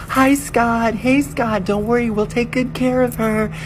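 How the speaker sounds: put-on very high, loud squealing girl voices; high-pitched excited group greeting; friends' squeal. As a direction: gush like tipsy friends on vacation. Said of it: "they're all like".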